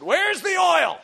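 high, loud demanding shout; calling out. Acted. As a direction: holler for it across the workshop. Acts out God calling for oil.

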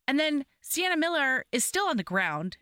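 ordinary delivery

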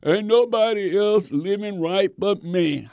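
high, loud whine of being the only one left; self-pitying Elijah. Retold or imitated. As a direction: complain like the last faithful man. Elijah's complaint voiced.

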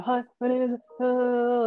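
lightly mocked overwhelmed greeting; imitating starstruck fan. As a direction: fumble a hello to an idol. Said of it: "beyond herself and is just like".

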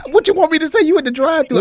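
high-pitched, exasperated comeback; mock-indignant pitch jump; retold protest. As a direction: protest it high, retelling your own reaction. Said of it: "I was like".

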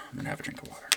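quick whispered aside; whispering. Real, not acted. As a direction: whisper it fast under your breath. Aside before drinking water.

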